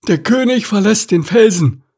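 very high ostrich announcement; Stanislav. A narrator's reading. Ostrich lookout announcing to the crowd, high-voiced. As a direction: proclaim it in a high bird voice. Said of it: "verkündete Stanislav".

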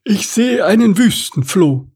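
high, odd ostrich voice; muffled ostrich reply. A narrator's reading. Ostrich with head in sand, muffled reply. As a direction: answer in a silly high bird voice. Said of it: "kam es dumpf zurück".

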